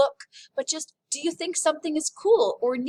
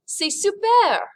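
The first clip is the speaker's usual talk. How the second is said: higher, louder, slow "C'est super" with delight; enthusiastic; 9 semitones higher, 7 dB louder, slower than usual. Shown as a demonstration. Teacher modelling the phrase to class: excited. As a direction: sell "super" like it's thrilling. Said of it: "pronounce it with a good French accent".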